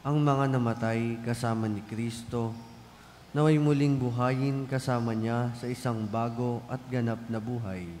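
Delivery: usual speaking voice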